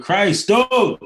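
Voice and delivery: high, loud exclamation; loud, high cry of exasperation; exasperated outburst. Real, not acted. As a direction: burst out in exasperation, pitch leaping up.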